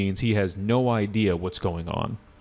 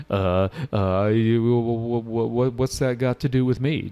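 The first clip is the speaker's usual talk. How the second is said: stammering bad actor. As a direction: overact the confused student with stammers. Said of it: "he kind of sounds like this".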